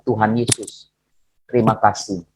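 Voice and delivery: low, soft retold thanksgiving; humble prayer. Injured preacher thanking Jesus despite his pain, humbly.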